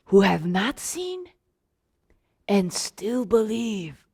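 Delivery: slow, soft and low, drawn out; tender blessing. Acted. Jesus answering Thomas, slow soft tender blessing. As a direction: lay each word down gently.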